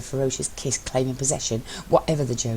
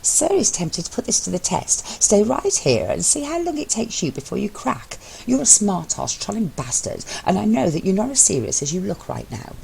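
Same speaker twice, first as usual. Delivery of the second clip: higher, exasperated mock threat; Mello, challenging; 7 semitones higher than usual. A narrator's reading. Mello daring his husband to crack, exasperated and higher. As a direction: call his bluff, half laughing.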